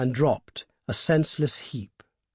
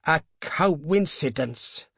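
slow, stuttered protest, pitched up; stammering; 5 semitones higher, much slower than usual. A narrator's reading. Old man doubting the paw's power, stammering. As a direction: stumble haltingly into the word. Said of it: "stammered the old man".

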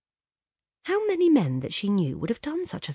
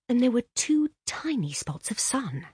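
whispering; 6 semitones higher than usual. Acted. Read in a whisper.

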